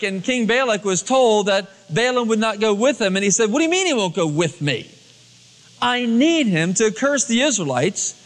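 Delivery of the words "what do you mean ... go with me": ordinary talk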